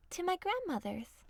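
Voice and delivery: put-on chirpy child answer; little girl voice. A narrator's reading.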